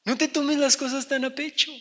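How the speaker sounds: chuckling through the advice, lightly; laughing advice. Retold or imitated. Pastor laughing while advising the offended brother. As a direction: laugh it off while telling him to calm down. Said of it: "Y tú dices".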